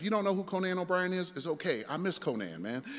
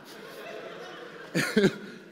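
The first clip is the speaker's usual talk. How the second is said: amused chuckle; 2 semitones higher than usual. Real, not acted. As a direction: chuckle at your own story. Laughs at the retold hang-up.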